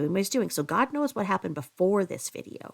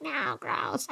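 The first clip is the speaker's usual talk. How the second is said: mock disgust with a laugh; imitated laughing protest; joking wife imitation; 6 semitones higher, 4 dB quieter than usual. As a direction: say it softly, then fake a cackle. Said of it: "they're joking and they're laughing".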